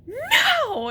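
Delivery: high, loud cry of terror on the drop; imitated scream on a roller coaster; terrified scream. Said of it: "and he's like".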